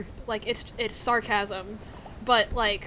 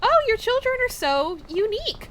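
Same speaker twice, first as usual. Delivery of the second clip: high, loud sugary church lady; high-pitched mock Southern politeness; 12 semitones higher, 7 dB louder than usual. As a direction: gush it like a sweet Southern churchgoer. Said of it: "they would be like".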